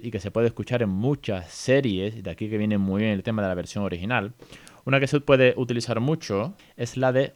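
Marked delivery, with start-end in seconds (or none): none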